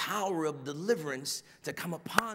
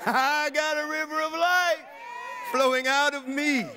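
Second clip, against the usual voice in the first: loud, high, laughing preaching shout; shouted proclamation; 8 semitones higher, 6 dB louder than usual. Real, not acted. Repeating the promise to the church, a loud exultant shout. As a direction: lift the line to a joyful roar. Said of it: "repeats line at full force".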